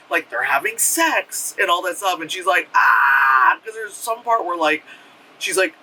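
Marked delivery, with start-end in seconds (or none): 2.7-3.6 sharp, loud, breathy scream; horrified scream. Retold or imitated. Girl sees herself losing control, screaming in horror. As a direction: let out a terrified cry.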